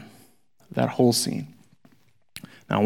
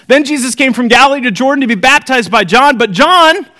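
raised, brisk Bible reading voice; scripture reading; 7 semitones higher, 3 dB louder than usual. Acted. Teacher reading the gospel to the class, lifted reading voice. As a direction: read the passage out to the class.

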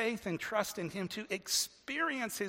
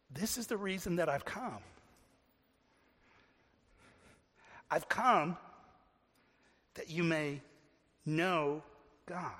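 reflective; 2 semitones lower, 4 dB quieter than usual. Real, not acted. Preacher unfolding Jesus' purpose to the congregation, reflective. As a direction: let each phrase land in silence. Preacher slowly dwelling on verse.

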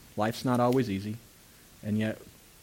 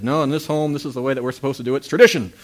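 hammed-up bossy husband, louder and higher; mock stern husband; 3 semitones higher, 8 dB louder, faster than usual. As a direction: play the pompous husband commanding his wife. Mock husband laying down law.

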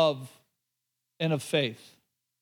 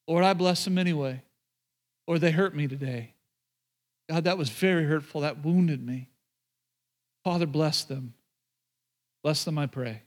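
prayer; 3 semitones lower, 4 dB quieter than usual. Shown as a demonstration. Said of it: "we are called as Christians to pay them back".

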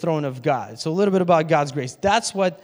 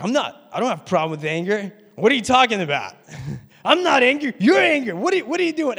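higher and louder, protesting he isn't angry; hammed-up denial that rises into an angry snap; mock defensive anger; 5 semitones higher, 4 dB louder, faster than usual. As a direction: snap back at the accusation, voice climbing. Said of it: "I'm like".

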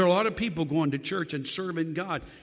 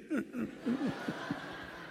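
own laughter; 9 semitones higher, 8 dB quieter than usual. Real, not acted.

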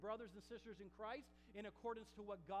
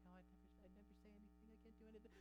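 tiny hushed voice, rushed; mock meek churchgoer; 15 dB quieter, much faster than usual. Preacher mimics meek people who come to church, timidly. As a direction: shrink to a barely audible mumble. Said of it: "the same meek and mild people".

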